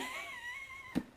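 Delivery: shrieking laugh; a high squealing laugh; high squeal of laughter. Real, not acted. Laughing at reply all.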